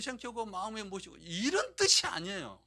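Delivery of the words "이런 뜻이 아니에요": loud, firm no; emphatic denial. Shown as a demonstration. Pastor to church, loud firm denial. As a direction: declare it is not so, loudly. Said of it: "먼저 이걸 아셔야 돼요".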